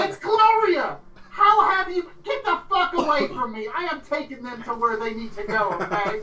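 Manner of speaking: high, loud squawk easing slightly; indignant acted outburst. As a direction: fend off a rival in a high voice. Gloria quarrels with the guide.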